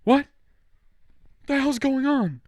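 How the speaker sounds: high, startled panic; panicked. Retold or imitated. Himself baffled by Foog appearing, high and panicked. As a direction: yelp it out in confusion. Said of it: "I'm like".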